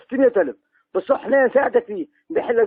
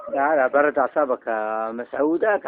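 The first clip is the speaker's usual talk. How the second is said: calming; 8 semitones lower, slower than usual. Acted. Calming an angry Masoud.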